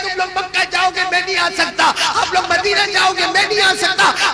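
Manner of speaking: high, loud tearful lament; a boy left behind, crying out in grief; crying child. Acted. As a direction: lament that you cannot go. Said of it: "Sheikh Abdul Qadir Jilani रो रहे".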